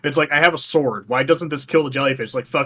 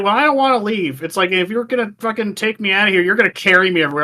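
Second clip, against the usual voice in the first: higher whiny mock princess voice; mocking Ruto; 5 semitones higher, faster than usual. The princess refusing to leave, higher whiny mock voice. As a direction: whine it like a spoiled brat.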